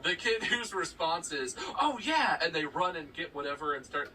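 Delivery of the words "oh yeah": high, loud, keen agreement; eager kid. Retold or imitated. Kid running to get his guitar, high and eager. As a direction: imitate a kid raring to perform.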